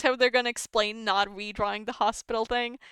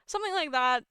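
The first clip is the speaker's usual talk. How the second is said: usual speaking voice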